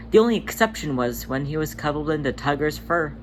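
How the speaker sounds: plain reading voice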